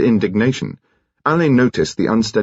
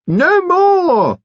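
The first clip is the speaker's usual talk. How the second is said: very high, loud, fluting alto; curious alto voice; 5 dB louder than usual. A narrator's reading. Mister Finn shooing his cats in a high alto. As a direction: sing it out like a fussy old aesthete. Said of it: "in his curious alto voice".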